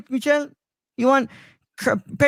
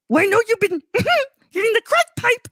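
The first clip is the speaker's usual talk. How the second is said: much higher, sing-song creepy clown; creepy clown calling down the sewer; Pennywise impression; 13 semitones higher than usual. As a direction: lure him in with a creepy high clown voice. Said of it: "Pennywise luring Kermit".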